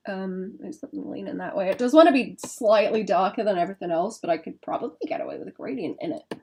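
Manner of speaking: ordinary talk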